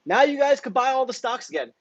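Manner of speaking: high, smug mock announcement; sarcastic high voice of the broker; mocking Robinhood. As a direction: parody the broker as a casual sellout. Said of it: "Robinhood said".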